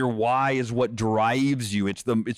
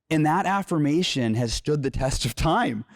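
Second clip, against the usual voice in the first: ordinary talk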